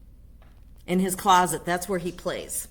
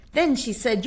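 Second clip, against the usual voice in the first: plain reading voice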